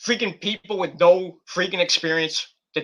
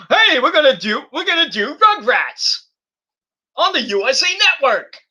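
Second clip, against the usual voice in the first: higher, louder mock executive pitch; mock eager pitch; 7 semitones higher, 3 dB louder than usual. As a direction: ham up a network suit selling an idea. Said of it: "and like".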